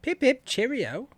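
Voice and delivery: hammed-up posh British phrase; mock British accent, said light and high. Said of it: "My accent sucks".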